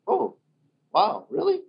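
high, clueless "oh wow really"; mock surprise. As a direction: act clueless and surprised, pitch shooting up.

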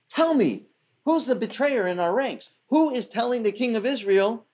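an enraged king hunting a traitor; furious king. Retold or imitated. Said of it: "he gets so mad".

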